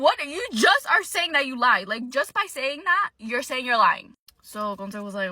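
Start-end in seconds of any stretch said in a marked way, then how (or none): 0.0-4.2 higher, louder mock outrage; indignant retort. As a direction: catch them out, incredulous. Retells indignant retort in argument.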